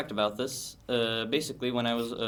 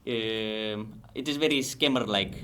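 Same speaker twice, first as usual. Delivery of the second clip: put-on Indian accent for a demo. Shown as a demonstration. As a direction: do a scammer-like Indian English accent. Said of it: "would you get an Indian accent".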